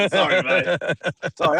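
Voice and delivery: real laughter spilling into the words; cracking up at your own tease; laughing apology. Real, not acted. Teasing joke lands, he apologises laughing.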